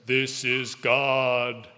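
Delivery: very slow, drawn-out booming announcement; booming voice of God. Acted. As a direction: boom it out slowly, like God from the sky. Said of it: "then the booming voice".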